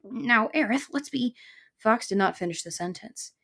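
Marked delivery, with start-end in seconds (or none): 0.0-1.4 high, wheedling appeal; placating fox. Acted. Fox reasoning with the angry porcupine, high wheedle. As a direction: try to soothe him before being cut off.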